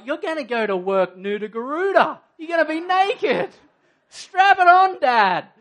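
rising to a cheeky yell at Dad; playful shout. Retold or imitated. Daughter urging Dad to strap on gentleness, teasing shout. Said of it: "She's like".

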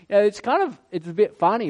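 usual speaking voice

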